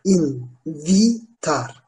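"Invitar" very slowly, as in-vi-tar with pauses; syllable by syllable. Shown as a demonstration. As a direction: sound out "Invitar" exactly as written. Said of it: "Yazıldığı gibi okunuyor". ("Invitar" kelimesini ağır ağır hecele)

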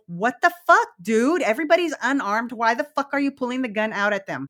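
retold disbelief at someone pulling a gun; mock outrage, challenging him; outraged protest. Retold outrage at cop drawing gun.

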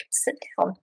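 soft unvoiced whisper; hushed, gentle whisper; whispered invitation. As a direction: whisper it like a quiet aside. Elder quietly inviting her.

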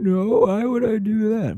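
very high, innocent question; clueless kid. Retold or imitated. Kid answering dad's scolding, very high and innocent. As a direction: ask it wide-eyed and high. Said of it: "I'm like".

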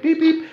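high-pitched beep beep, like a scanner; imitated machine beep. Imitating the swab scanner with a high beep. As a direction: mimic the scanner's chirp, up high.

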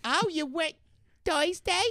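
high squeaky falsetto, far above his own; douche flute voice. Pretend. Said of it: "do that voice".

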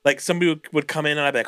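ordinary talk, nothing marked

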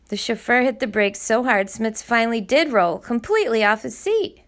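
plain reading voice

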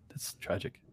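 breathy mock-tragic whisper. Mock lament about Wigger ban.

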